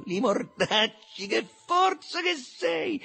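high, drawn-out gleeful shout; cheerful exclamation. A narrator's reading. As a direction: cheer him on, stretching each word. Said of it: "allegro borbottò". (esclamazione allegra, acuta e strascicata)

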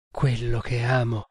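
ordinary delivery, nothing marked

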